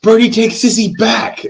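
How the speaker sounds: high, loud mock battle cry; mimicking a small son's excited yelling; imitated toddler yell. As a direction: yell it like a little boy mid-attack, playfully imitated. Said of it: "grabs her by the throat and yells".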